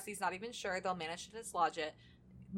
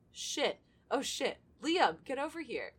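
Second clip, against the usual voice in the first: louder, higher alarmed call; alarmed shout; 6 semitones higher, 6 dB louder, slower than usual. Acted. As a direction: shout for Liam in shock. Finds body, calls friend.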